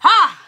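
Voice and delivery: sharp high yelp of pain; startled cry of pain. Retold or imitated. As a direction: cry out suddenly. Retelling sudden pain.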